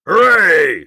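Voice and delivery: loud high cheer; king cheering his new height; shouted. Acted. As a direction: whoop with triumph from the top. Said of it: "shouted Yertle".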